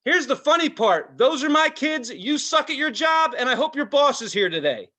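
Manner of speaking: angry shout at the rude employee; retold yelling. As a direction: chew out the ride worker. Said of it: "I was just yelling".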